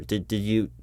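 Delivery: ordinary talk, nothing marked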